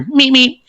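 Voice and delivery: high squeaky Roadrunner meep meep. Retold or imitated. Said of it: "you hear".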